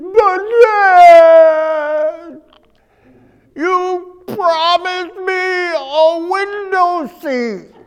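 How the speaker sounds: put-on high, crying little-girl wail, drawn out slowly; mock sobbing girl. Little girl wailing at her dad, mock sobbing. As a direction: whine like a heartbroken child. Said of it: "broke into sobbing".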